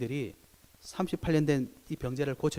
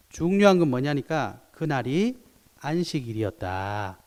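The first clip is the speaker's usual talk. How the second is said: normal talking voice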